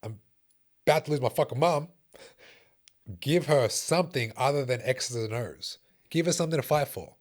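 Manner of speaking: heated and emphatic, reliving the anger; impassioned plea. Retold or imitated. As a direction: plead angrily for some compassion. Heated plea about mother's treatment.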